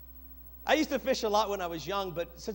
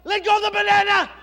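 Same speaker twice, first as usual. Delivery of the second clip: laughing shout, much higher; yelling; 12 semitones higher, 14 dB louder, faster than usual. Retold or imitated. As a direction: yell it through laughter. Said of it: "and I yell".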